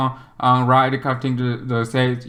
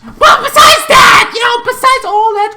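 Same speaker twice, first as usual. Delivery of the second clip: excited outburst; 9 dB louder than usual. Acted. Telling viewers about the crash damage with a loud burst. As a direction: blurt it out big. Exaggerated relief.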